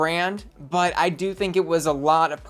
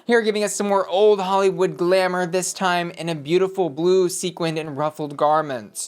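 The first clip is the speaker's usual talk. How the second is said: ordinary talk, nothing marked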